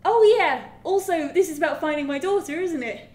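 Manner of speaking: high, loud burst on "oh yeah", then easing; mock sudden realisation. Player suddenly remembering the missing daughter, mock realisation. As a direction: blurt it as a hammed-up afterthought. Acts out sudden reminder in game.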